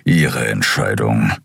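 low, slow grumble; deep, drawn-out rumble; grumbling. A narrator's reading. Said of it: "brummte Keru".

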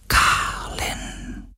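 drawn-out ghostly whisper; a lingering, voiceless call; longing whisper. A narrator's reading. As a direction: whisper the name slowly, full of yearning. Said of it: "flüsterte er".

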